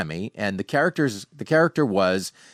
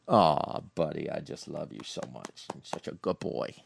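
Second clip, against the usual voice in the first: soft, low, nearly whispered love for the pet; tender cooing to dog; 7 semitones lower, 9 dB quieter than usual. Real, not acted. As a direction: whispers to his dog how much he loves him. Cooing lovingly to his dog.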